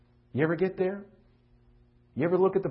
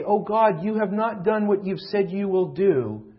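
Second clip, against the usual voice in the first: mock angry complaint; 5 semitones higher, 6 dB louder than usual. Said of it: "when we get angry and say".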